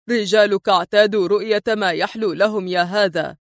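ordinary delivery, nothing marked

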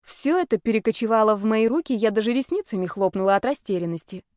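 ordinary delivery

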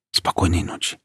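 soft unvoiced whisper. A narrator's reading. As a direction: whisper it gently to grandma. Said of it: "прошептала она".

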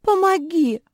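very high, loud wail; sobbing plea. A narrator's reading. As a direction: plead like a tearful little sister. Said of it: "всхлипнула Жози". (жалобно, громко, высоким голосом)

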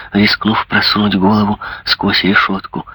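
usual speaking voice

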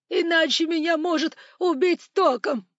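high, loud frightened wail; desperate tearful shout; panicked cry. A narrator's reading. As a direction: cry out in terror for your life. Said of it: "неожиданно закричал управляющий".

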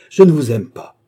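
high, loud frantic refusal; cry of refusal. Acted. Jeanne crying out against her seducer, loud and high. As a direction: push him away with your voice.

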